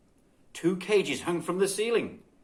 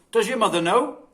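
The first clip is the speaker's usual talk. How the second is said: louder, higher motherly question; a kindly neighbour checking; concerned woman; 7 semitones higher, 7 dB louder than usual. A narrator's reading. Mrs Cole asks the child.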